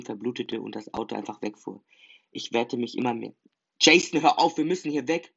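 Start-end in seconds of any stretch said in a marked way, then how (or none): 3.8-5.3 higher, loud urgent shout; a firm, urgent shout back. Acted. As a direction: yell at him to stop. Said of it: "schrie er zurück".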